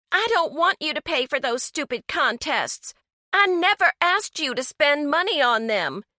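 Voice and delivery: put-on a teen biting back at her mother; snapping back. A narrator's reading. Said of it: "Francesca snapped".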